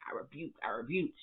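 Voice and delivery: hushed, breathy prayer against evil; whispered rebuke. Pretend. Vlogger rebuking the couple, in a hushed whisper. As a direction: whisper it like warding off a spirit.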